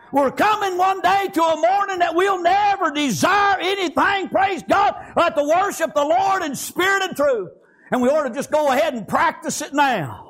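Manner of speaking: high, driving sermon cadence; impassioned preaching. Real, not acted. Preacher warning congregation about weak flesh, high impassioned preaching.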